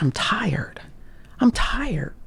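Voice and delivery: whispered, worn-out sigh of words; tired whisper. Retold or imitated. Complaining of exhaustion to herself, in a worn whisper. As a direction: barely get the words out, exhausted.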